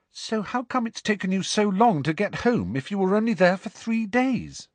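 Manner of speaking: put-on high, insistent girl's question; Hermione, puzzled. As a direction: press him for an explanation, high-voiced.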